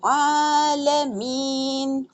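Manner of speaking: आलमीन with ain from mid-throat, very slow. Shown as a demonstration. Teacher showing the throat ain, slowly chanted. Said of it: "आइन को हलक के दरमियान से अदा करना है".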